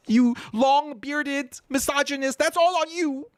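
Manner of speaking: high mock-whiny voice blaming; hammed-up whiny accusation at long-bearded mullahs; mocking accuser. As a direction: keep the falsetto parody, pointing fingers. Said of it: "the tears start coming down".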